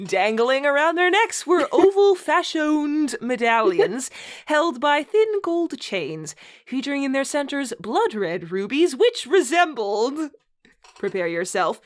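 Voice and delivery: mock-grand read-aloud, stifling laughter; story narration. Host reading a bad fanfic to co-host, mock-solemn narration. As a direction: read the purple prose with a straight face.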